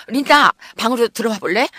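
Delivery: high, lilting call to a friend. A narrator's reading. Judy calling Linda from inside the bedroom, calling out. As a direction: call her in from another room. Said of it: "나를 부르는 소리가 들렸다".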